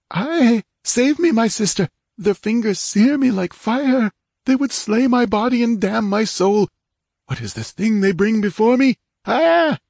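terrified cry. Acted. Dying king cries to sister in mounting terror. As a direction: scream for rescue as claws close in. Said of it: "the terror in his hopeless cry".

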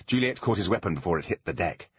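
usual speaking voice

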